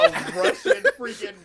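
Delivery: very high, loud laughing squeal; squealing disbelief. Retold or imitated. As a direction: shriek it in disbelief. Incredulous squeal mid-story.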